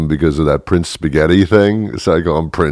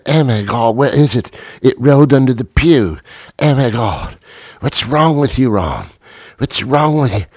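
higher, frantic groom yelling at his friend; panicked groom; 7 semitones higher than usual. Acted. Groom panicking at his friend over the dropped ring, higher and frantic. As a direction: panic as the ring rolls away. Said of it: "Harry panics over lost ring".

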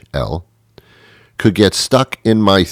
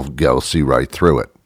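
normal talking voice

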